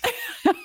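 high, soft burst of laughter; real laugh. Real, not acted. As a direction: laugh at seeing it coming. Laughs at predicted Bud Light comment.

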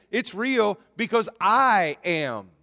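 "I am" slowed and leaned on; "It's real because I am" said slowly with weight on "I am"; stress on I am. Acted. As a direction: land hard on "I am". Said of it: "he is emphasizing the words, "I am".

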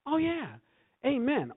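high-pitched, fired-up agreement; excited affirmation. Acted. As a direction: cry it out with rising zeal. Acts out own excited affirmations.

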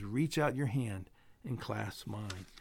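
a hushed, gentle call to take his hand; tender voice of God. Retold or imitated. God reaching down to the sinner, hushed and tender. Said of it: "and all he says".

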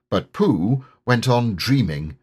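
ordinary delivery, nothing marked

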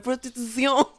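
mock foreign accent, high and laughing; Chester. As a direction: giggle through an imitated accented flourish. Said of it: "when he was like".

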